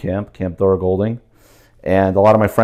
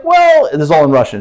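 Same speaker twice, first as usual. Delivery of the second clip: very high, louder put-on woman's voice; high-pitched female chatbot hedging; ChatGPT; 5 semitones higher, 4 dB louder than usual. Speaker mimics the AI doubting him, high mock woman's voice. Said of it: "like, a woman's voice in Chat G P T".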